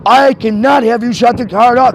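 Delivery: loud, high, desperate shouting; furious shouting. Real, not acted. Customer shouting at the bank agent not to shut off the card, furious. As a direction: yell to stop them cutting the card.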